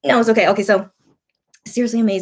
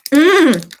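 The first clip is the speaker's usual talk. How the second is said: savoring mm; 4 semitones higher, 7 dB louder than usual. Real, not acted. Tasting the cream.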